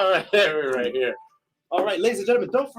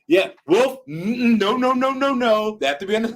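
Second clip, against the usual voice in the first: mock scolding; 9 semitones higher, 6 dB louder than usual. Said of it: "he's like".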